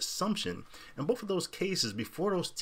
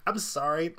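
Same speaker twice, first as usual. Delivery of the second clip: ordinary delivery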